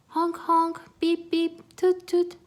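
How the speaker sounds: high, loud honking horn sounds; loud high tooting car horns; car horn noises. Acted. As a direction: honk like city traffic. City traffic horns imitated.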